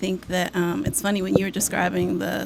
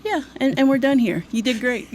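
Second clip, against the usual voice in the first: therapist voice; 6 semitones higher, 4 dB louder than usual. Retold or imitated. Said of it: "That's your therapist voice".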